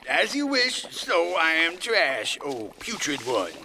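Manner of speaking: loud, theatrical obeisance; hammed-up exit line; servile declamation. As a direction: abase yourself grandly before the master. Servant takes leave of tyrant.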